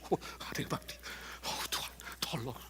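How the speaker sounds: whispered panicked little prayer; scared whisper. Retold or imitated. Man hearing of a death, whispering a scared prayer. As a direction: breathe it out like a frightened plea.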